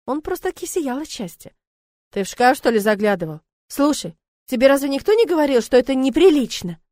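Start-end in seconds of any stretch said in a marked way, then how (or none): 2.1-6.8 louder, indignant scolding. A narrator's reading. Woman scolding a guest for snooping, indignant and loud. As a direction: scold him for peeking into her closet. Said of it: "возмутилась она".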